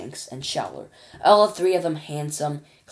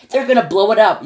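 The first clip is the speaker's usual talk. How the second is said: loud, higher panicked yell; 6 semitones higher, 12 dB louder than usual. Acted. Ground control yelling in panic about the hotel. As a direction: yell it in alarm. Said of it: "yelled ground control".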